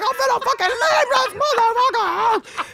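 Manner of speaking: high, loud raging shout; furious yell. Retold or imitated. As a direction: screams she's leaving. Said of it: "to this crazy bitch".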